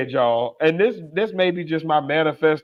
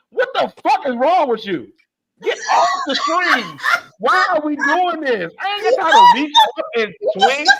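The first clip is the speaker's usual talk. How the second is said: high, raised comic yell at the bed partner; exasperated mock shout; 9 semitones higher than usual. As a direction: throw up your hands and holler at her.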